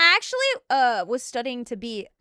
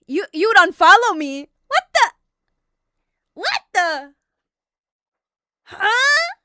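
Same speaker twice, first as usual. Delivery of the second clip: loud, high indignant disbelief; hammed-up shocked protest; mock outrage; 7 semitones higher, 10 dB louder than usual. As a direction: react as if deeply betrayed. Mock outrage at being unfollowed.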